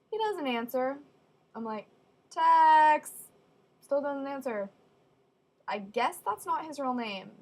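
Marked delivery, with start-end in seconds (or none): 2.3-3.1 loud shout of Tex; calling out. Real, not acted. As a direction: yell Tex across the room. Calling again, no answer.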